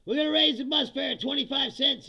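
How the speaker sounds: high, loud pompous government voice; mock official announcement. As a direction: announce the fare hike smugly. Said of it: "they say".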